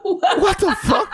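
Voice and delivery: high, drawn-out incredulous squeal, laughing; mock outraged disbelief. Host retelling his reply to Glasses, comically incredulous. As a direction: stretch it way up high, comically appalled. Acted retort to being called a cuck.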